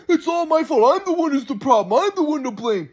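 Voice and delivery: high mock self-blame, sneering; sarcastic grandpa. Grandson mimics grandpa playing the martyr, sarcastic. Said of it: "insinuating that it's really my fault".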